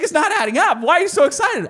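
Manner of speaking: mock the wife pointing out the losing math; exasperated wife. Husband imitating his wife doubting the business, high and exasperated.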